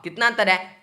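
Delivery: ordinary delivery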